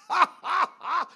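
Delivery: loud laugh ringing out over the congregation; hearty shouted laugh. Acted. Preacher laughing loudly to show joy beating the devil, loud laugh.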